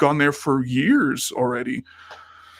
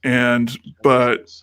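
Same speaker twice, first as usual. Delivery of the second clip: usual speaking voice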